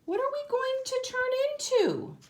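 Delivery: a small creature asking eagerly; high pollywog voice, curious. Acted. Pollywog asks in story.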